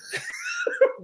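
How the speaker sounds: imitated high, wheezy panting; heavy breathing. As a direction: act out gasping for air after a hill run. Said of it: "breathing heavy".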